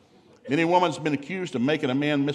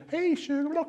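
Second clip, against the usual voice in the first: high mock sweet wife voice. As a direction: coo at him from the couch. Wife sweetly interrupting ball game.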